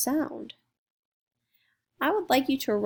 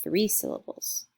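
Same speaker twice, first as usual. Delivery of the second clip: normal talking voice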